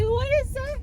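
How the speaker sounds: high, hushed disbelief; startled question. Retold or imitated. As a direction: ask it stunned and breathless. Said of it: "I was just like".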